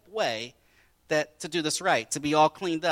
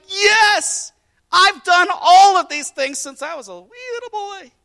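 much higher, louder eager boast; excited young man; 15 dB louder than usual. Acted. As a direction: play him thrilled and proud, with a laugh. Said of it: "he was excited".

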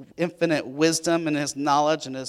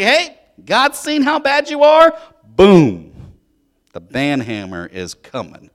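mock doom announcement; 4 semitones higher, 12 dB louder, slower than usual. Jonah delivering God's judgment message.

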